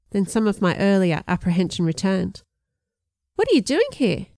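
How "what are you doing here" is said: surprised. A narrator's reading. Girl surprised by teacher.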